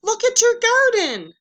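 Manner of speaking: high, loud delighted cry; excited. Acted. Frog excitedly shows Toad his sprouting garden. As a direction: burst out with joy.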